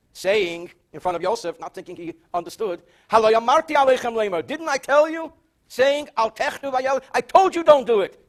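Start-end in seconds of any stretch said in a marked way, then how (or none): 3.1-8.3 raised, higher, reproachful voice; I-told-you-so scolding. Retold or imitated. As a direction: act out a brother berating the others. Reuven scolds his brothers.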